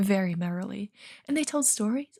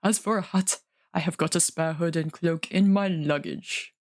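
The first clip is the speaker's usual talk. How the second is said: gruff dwarf; 4 semitones lower than usual. Acted. Dwalin reassuring Bilbo about handkerchiefs, low dwarf voice. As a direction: speak low and steady like a dwarf.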